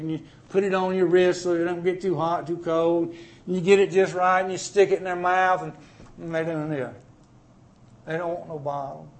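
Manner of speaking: usual speaking voice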